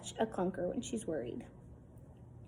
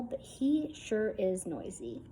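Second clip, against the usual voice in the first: usual speaking voice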